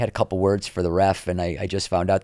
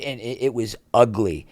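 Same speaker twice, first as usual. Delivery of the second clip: normal talking voice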